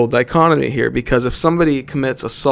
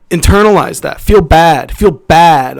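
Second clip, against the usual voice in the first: louder, higher, hammering each order home; stern scolding; 4 semitones higher, 6 dB louder, slower than usual. Real, not acted. Ranting at child viewers, louder stern orders. Scolding kids watching the video.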